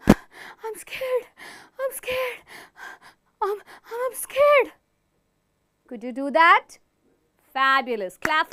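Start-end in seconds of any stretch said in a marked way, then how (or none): 0.1-4.7 high, breathy, stammering fear; scared. Shown as a demonstration. Teacher acting fright for the class, high and stammering. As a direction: shrink back gasping I'm scared. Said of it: "while breathing faster".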